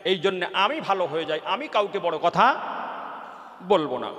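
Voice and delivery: impassioned waz sermon, rising then calming; fiery preaching. Acted. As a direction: preach the lesson to the crowd with full force. Preaches the moral.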